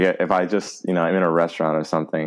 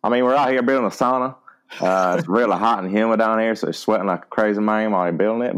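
put-on South Carolina accent; 2 semitones higher than usual. Answering a friend's hobby question on request, South Carolina accent. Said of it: "in a South Carolina accent".

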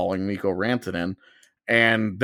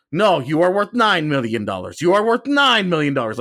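insistent hypnotic chant, higher and louder; Jedi mind trick; 6 semitones higher, 4 dB louder than usual. Retold or imitated. Front office working on the player, mock hypnotic insistence. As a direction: drill the number in like a mind trick. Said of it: "like a Jedi mind trick".

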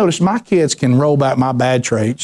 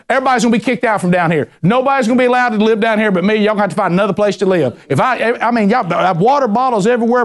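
higher, fast mock rant at the kids; dad's rant; 8 semitones higher than usual. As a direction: rattle off a hammed-up fatherly rant. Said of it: "before I start ranting about you".

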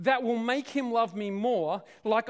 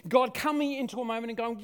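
normal talking voice

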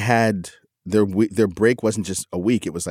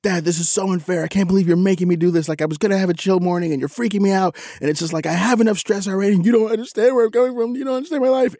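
put-on high, fast whiny teenage rant; mock teen meltdown, much higher; imitating teen's outburst; 11 semitones higher, faster than usual. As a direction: mimic the son blowing up, pitch climbing. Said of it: "He just snaps at me".

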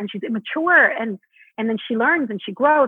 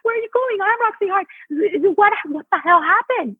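much higher, indignant diva cry; a starlet stunned that the reporters leave; Roxy Hart outraged; 9 semitones higher than usual. Acted. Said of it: "Roxy's like".

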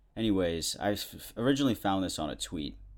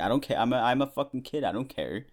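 higher, louder mock protest; indignant retort; 5 semitones higher, 5 dB louder than usual. Telling off the nurse in his head, indignant and raised. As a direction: fire back like a kid who doesn't care.

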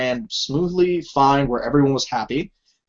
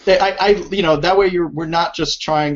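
ordinary talk

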